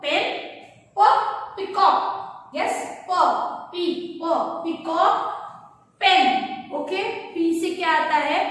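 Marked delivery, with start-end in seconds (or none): 2.6-3.1 प as a voiceless puff of air; puffed p sound. Shown as a demonstration. Teacher showing kids the breathy p puff. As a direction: pop प with just breath, no voice. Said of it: "क्या sound निकला है पी का?".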